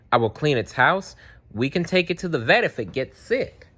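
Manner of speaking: put-on a small boy promising to be good; child pleading his case. A narrator's reading. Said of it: "Franklin makes his case".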